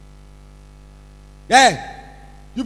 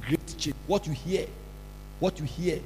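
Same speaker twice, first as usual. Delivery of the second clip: ordinary delivery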